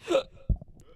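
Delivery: sharp involuntary hiccup; a loud unvoiced hiccup; hiccup gasp. Real, not acted.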